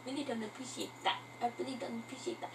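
very quiet, quick echo; mimicking oneself. Vlogger echoing their own odd phrasing, hushed self-mimicry. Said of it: "I don't know why I talk like that".

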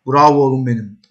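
ordinary talk, nothing marked

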